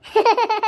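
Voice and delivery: loud burst of gleeful laughter; delighted cackle. Acted. Mother delighted by sweets. (खुशी का ज़ोरदार ठहाका)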